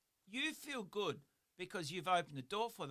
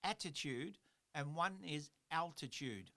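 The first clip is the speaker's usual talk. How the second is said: slow, careful. Shown as a demonstration. Speaker separating two similar-sounding words for listeners, slow and careful. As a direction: say "attitude" and "altitude" slowly, set apart. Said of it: "I'll try and say 'em slowly".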